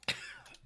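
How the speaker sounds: sudden cough from swallowing wrong; choking cough. Real, not acted. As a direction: cough as a drink goes down the wrong pipe. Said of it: "it went down the wrong pipe".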